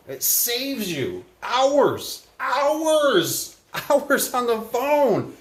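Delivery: HOURS stretched out long and high each time; high, exaggerated, drawn-out "hours"; drawn-out emphasis. Real, not acted. Hammering home the time saved.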